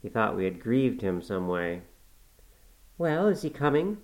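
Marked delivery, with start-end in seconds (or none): 3.0-4.0 cried out. A narrator's reading. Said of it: "cried Catherine".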